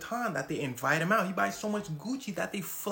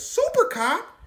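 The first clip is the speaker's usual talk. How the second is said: much higher, loud shocked question; shocked crowd; 11 dB louder, slower than usual. Retold or imitated. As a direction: gasp out the name. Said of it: "Everybody says".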